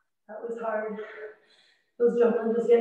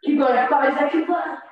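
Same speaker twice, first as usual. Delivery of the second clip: loud encouragement; 4 semitones higher, 6 dB louder than usual. Real, not acted. Counting down during exercise.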